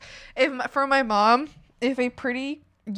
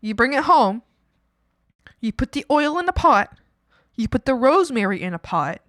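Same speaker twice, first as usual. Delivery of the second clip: usual speaking voice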